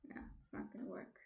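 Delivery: a resigned whisper under the breath. Real, not acted. Host giving up on the broken camera, real whisper. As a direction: whisper it to yourself, defeated. Camera failing, gives up softly.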